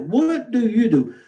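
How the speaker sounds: a hint of a big shot sizing you up; pompous question. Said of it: "That's the first thing".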